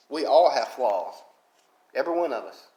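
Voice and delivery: ordinary talk, nothing marked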